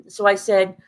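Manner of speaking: normal talking voice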